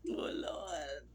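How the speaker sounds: real tearful groan. Real, not acted. Overcome while recalling husband.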